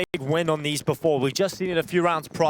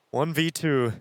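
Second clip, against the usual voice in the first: normal talking voice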